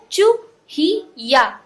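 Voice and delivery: "चू ही या" slowly, as three separate syllables; teacher slowly sounding out "चू ही या". Shown as a demonstration. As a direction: stretch out each part of "चू ही या". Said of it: "यानी ये क्या बन गया".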